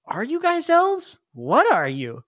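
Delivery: high, slow wary question; startled kid. Acted. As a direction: stare and ask in disbelief.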